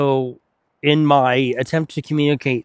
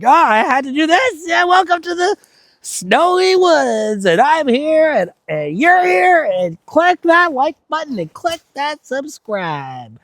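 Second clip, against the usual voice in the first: high, slow, overexcited vlogger voice; hyped YouTuber; 14 semitones higher, 3 dB louder than usual. Retold or imitated. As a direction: ham up a YouTuber intro. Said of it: "my excitement doesn't derive from".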